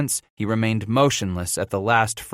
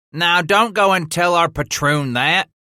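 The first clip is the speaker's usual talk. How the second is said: loud, higher sour grumble; 9 semitones higher, 5 dB louder than usual. Acted. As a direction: grumble it sourly at the boy. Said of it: "grumbled Reuben Root".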